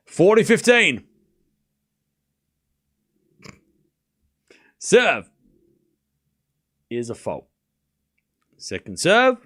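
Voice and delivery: brisk running commentary, rising at each name; live play-by-play. Acted. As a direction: call the rally as it happens.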